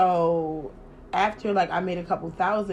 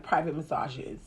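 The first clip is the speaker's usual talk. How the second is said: ordinary talk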